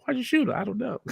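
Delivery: high-pitched comic bit; high voice dissolving into giggles; mock acted exchange. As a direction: squeak out a silly exchange, breaking into laughter. High acted exchange, then laughing.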